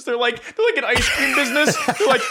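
ordinary delivery

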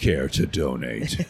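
low, slow, menacing offer; a low, slow, sinister invitation; malevolent villain. Retold or imitated. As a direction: drop the accent and let the menace creep in. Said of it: "and then malevolence".

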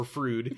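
"Frood" for Freud, rhyming with "food"; wrong vowel in "Frood", a long oo; mispronounced Freud. Shown as a demonstration. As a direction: say "Frood" with an oo in place of the oy. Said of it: "Yes, exactly".

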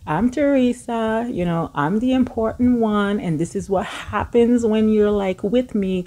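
lightly mocking a self-important friend; mock Teresa. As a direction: play her as the one who matters. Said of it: "She was just kind of like".

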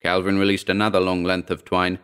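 plain reading voice

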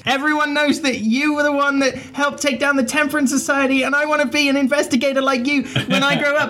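high, fast, breathless fanboy; eager young fan. Acted. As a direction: gush like an excited young fan.

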